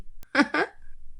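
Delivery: soft, high, brief chuckle; a quiet snicker at your own jibe; mocking little laugh. Character laughs at mother-in-law's remark.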